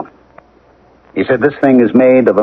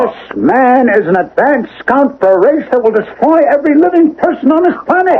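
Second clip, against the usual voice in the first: impassioned warning. Acted. Husband warns the policeman friend of an alien race, urgent and much higher.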